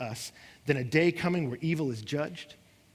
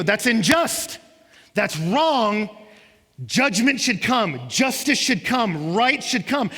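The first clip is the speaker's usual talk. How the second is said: loud, higher and slowing on key words; indignant outcry; 5 semitones higher, 7 dB louder, slower than usual. Retold or imitated. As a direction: cry out that it must be made right. Voicing outrage at evil.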